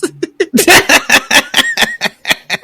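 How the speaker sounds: a loud, high helpless laugh; high laughter. Real, not acted. Reader laughing at the doctor's lies, loud and high. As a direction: let the laugh run high.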